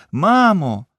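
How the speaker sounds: high, loud cry for mom; a child's sudden scream. A narrator's reading. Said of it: "з криком".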